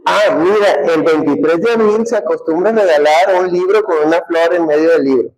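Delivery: mock a partner dropping gift hints; hinting girlfriend. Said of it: "te dan la sugerencia de que".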